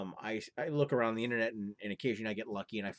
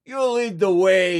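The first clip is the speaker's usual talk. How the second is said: higher, loud slow yell; 8 semitones higher, 17 dB louder, slower than usual. Acted. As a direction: yell back at the giant. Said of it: "yelled Little John".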